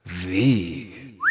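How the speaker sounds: low, drawn-out "Vi"; mock-seductive low letter sound; romantic. Shown as a demonstration. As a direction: linger lovingly on "Vi". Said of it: "solo que más romántica".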